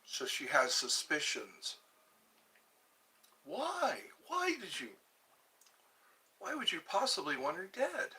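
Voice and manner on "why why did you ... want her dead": surprised. Real, not acted. Shocked at twin wanting her dead.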